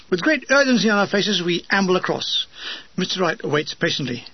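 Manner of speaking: usual speaking voice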